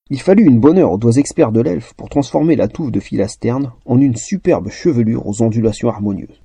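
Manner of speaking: ordinary delivery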